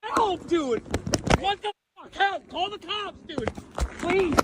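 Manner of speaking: high, frantic yelling for help; panicked cries for help. Real, not acted. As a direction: scream for help in real fear. Driver calls for help, tased.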